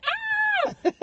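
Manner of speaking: very high mock shriek; startled sister's scream, acted out; imitated high scream. Sister startled in the crashed car, mock scream.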